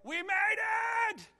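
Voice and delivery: whooping high and loud with joy; joyful whoop. Retold or imitated. Father twirling his kid, celebrating with a high, loud whoop. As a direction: cheer like you're spinning your kid round. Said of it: "you twirl him and you say".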